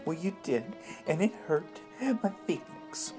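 hurt. Acted. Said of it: "don't cry".

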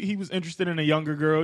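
ordinary talk, nothing marked

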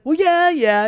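very high, loud squeal of a reply; hammed-up protest. Pastor answers neighbor's disbelief, hammed-up high protest.

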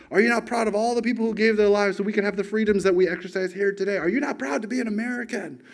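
high, insistent mock yell of an old lady; retold scolding. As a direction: imitate the angry clerk lecturing him. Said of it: "She's, like, yelling".

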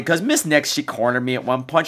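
ordinary talk

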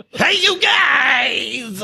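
high, drawn-out mock shout; hammed-up hollering. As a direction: call out high, stretching the last word. Trying out an impression.

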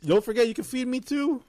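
playful whine. Pretend. Playful high plea.

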